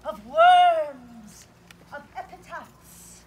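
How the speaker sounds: loud, grand stage speech; hammy Shakespeare declamation. Acted. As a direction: declaim like a tragic actor.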